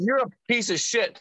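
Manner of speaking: high-pitched mock outrage; retold indignant outburst. Retelling his answer to the caller's prank, high indignant mock outburst. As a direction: replay cursing out a prankster.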